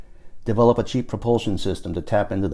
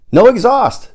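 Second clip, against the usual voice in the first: much higher and louder, "No exhaust!" falling hard on "exhaust"; excited confirmation; 12 semitones higher, 8 dB louder, slower than usual. A narrator's reading. Paul answering his friend's doubt, thrilled "No exhaust!". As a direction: fire back "No exhaust!" like a triumphant reveal.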